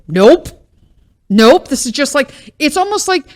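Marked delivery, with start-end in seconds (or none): 0.1-0.5 first firm nope; emphatic nope. Real, not acted. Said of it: "repeated flat refusal". 1.3-1.7 stamp the second nope down; emphatic nope again. Real, not acted. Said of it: "repeated flat refusal".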